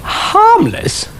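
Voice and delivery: very high, scoffing with a laugh; mocking laugh. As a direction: laugh the word off in scorn.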